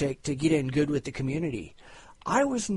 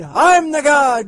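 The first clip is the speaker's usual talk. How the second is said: even higher, slow, drawn-out boast; mad scientist; 9 semitones higher, 11 dB louder, slower than usual. Acted. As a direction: stretch it out, crazed and grand.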